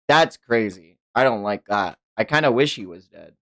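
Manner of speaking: usual speaking voice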